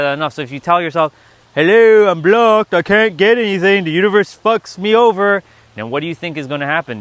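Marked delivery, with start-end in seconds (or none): 1.5-5.4 loud, high mock whine; exaggerated self-pity, pitched up and projected; mock complaining. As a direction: wail it like a hammed-up victim. Mocking self-pitying self-talk.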